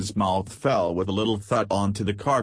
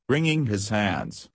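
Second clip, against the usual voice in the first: ordinary delivery, nothing marked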